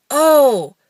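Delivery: long, loud "oh" of dawning understanding; mock realization. Translator hearing the learners' confusion, drawn-out realizing "oh".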